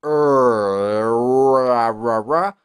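long loud er rolling into ra, ra; drawn-out er drill. Shown as a demonstration. Teacher exercising the tongue for English r, long loud er. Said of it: "está en la lengua y en la parte baja de la boca".

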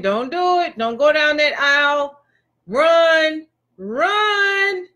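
urgent warning, climbing on the last word; warning inner voice. Acted. Inner voice warning a bride at the aisle, urgently. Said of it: "You hear that inner voice saying".

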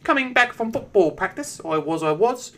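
lightly mocking high kid voice; little kid voice. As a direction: mimic a ten-year-old boy telling his story. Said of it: "And he's like".

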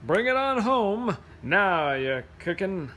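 rhythmic, slightly slow picture-book reading; reading to children. Acted. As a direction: read it like a bedtime rhyme with swing. Reads picture book aloud.

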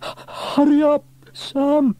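high, loud, drawn-out yell; anxious call. A narrator's reading. Trevor clinging to the ladder below, calling up anxiously. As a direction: yell up to someone far above. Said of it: "called Trevor".

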